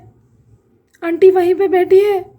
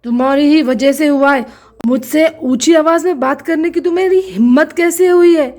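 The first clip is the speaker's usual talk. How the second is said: much lower, heavy accusing man's voice; a deep-voiced husband laying the blame; stern husband; 4 semitones lower than usual. Husband figure scolds.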